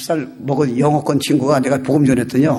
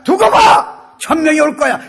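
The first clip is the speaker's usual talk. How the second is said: high, loud, boastful retort; defiant comeback; 11 semitones higher, 4 dB louder than usual. Shown as a demonstration. Preacher showing how to answer doubters of evangelism, defiant shout. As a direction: fire it back with total confidence. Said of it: "이렇게 좀 얘기하라니까요".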